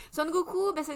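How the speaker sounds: Son Goku burst out high and loud; a fan's delighted cry of the name; excited. Real, not acted. Host thrilled to introduce her favourite hero, excited shout.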